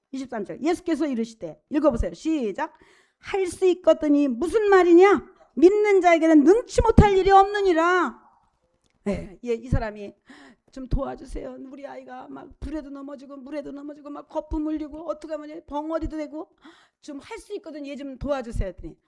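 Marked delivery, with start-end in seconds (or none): 3.2-8.2 raised, higher, declaiming voice of authority; loud scriptural rebuke. Acted. Preacher voices Jesus answering a doubting father, loud rebuke. 10.7-18.7 soft, run-on plea for help; pleading parent. Acted. Preacher acts a father begging Jesus for his child, soft pleading.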